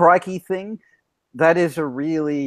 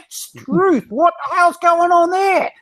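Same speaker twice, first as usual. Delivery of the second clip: high, drawn-out Australian country exclamation; hammed-up Australian; 3 dB louder, slower than usual. Winding up Americans with Aussie slang, hammed-up Australian accent. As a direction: ham up the Aussie accent to wind them up. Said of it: "just to get you guys going".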